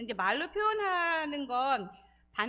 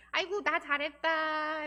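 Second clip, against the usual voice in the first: slow, high, loud sing-song praise; self-praise; 5 semitones higher, 5 dB louder, slower than usual. Shown as a demonstration. Counselor leading everyone in praising themselves aloud, exaggerated sing-song. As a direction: congratulate yourself with long stretched endings. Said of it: "셀프 칭찬 한번 해볼까요?".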